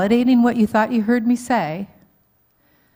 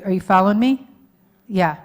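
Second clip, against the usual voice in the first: ordinary talk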